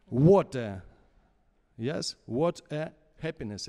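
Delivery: what punched hard and loud in what a happiness; stress on what. Shown as a demonstration. Coach showing the singer emphasis on what. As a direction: hit the word what, the rest lighter. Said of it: "you can make an emphasis in every word".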